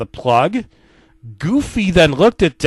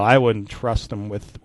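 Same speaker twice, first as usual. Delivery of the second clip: usual speaking voice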